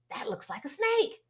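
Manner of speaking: softly voiced onlooker's observation; hushed cautious verdict. Bystander looking under the car for them, hushed.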